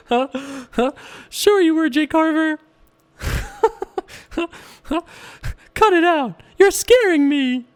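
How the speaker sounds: high teasing voice, drawn out; mock scared squeal at the player; high put-on voice. Teasing player Jay Carver in a high put-on voice.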